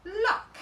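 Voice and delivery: Luck said high and loud with a rise; blended word. Shown as a demonstration. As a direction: call out Luck as the blended answer. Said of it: "Sounded it out down my arm".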